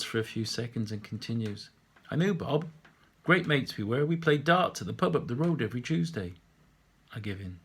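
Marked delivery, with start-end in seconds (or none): 2.1-6.3 a lightly voiced old local, raised; chatty old man. Old man telling the painter about Bob, lightly voiced and chatty. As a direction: reminisce like a pub regular.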